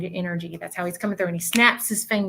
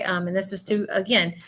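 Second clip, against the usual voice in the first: ordinary talk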